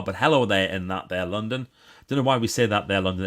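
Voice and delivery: ordinary talk, nothing marked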